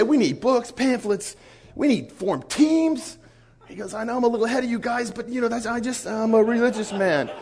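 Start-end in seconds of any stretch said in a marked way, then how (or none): 0.0-3.2 excited retelling. Retold or imitated. Excited convert planning outreach. 3.9-7.3 fast excited retelling. Retold or imitated. Excited convert explains himself.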